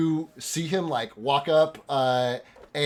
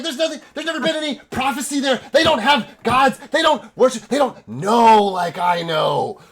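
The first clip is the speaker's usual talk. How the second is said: high, loud zealot's rant; crazed prophet's tirade; insane elf; 10 semitones higher, 7 dB louder than usual. Acted. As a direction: rail fervently, stretching out the last words. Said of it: "fucking insane elf".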